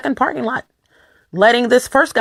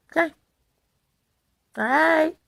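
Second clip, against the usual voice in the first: normal talking voice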